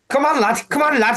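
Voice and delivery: high mock Scouse cheer; hammed-up Scouse call; mock Scouse lad. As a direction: gee them up like a Scouse dad. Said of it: "Saying lad all the time".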